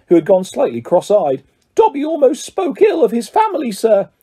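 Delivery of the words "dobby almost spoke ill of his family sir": high, contrite little elf; Dobby, house elf. A narrator's reading. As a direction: explain humbly in a high creature voice. Reader voicing the house elf.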